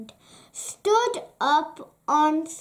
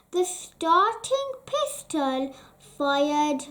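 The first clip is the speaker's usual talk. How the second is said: usual speaking voice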